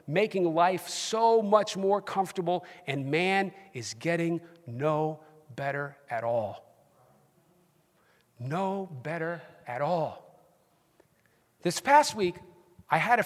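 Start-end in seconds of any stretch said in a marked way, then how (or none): 2.8-10.4 slow, softer and grave; grave lament. Real, not acted. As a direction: let it sink in slowly, quietly. Solemn lament on mankind's decline.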